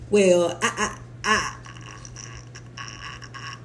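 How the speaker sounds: stuck on I with a laugh breaking through; mock stammer. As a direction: imitate a presenter who freezes. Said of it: "And he's like".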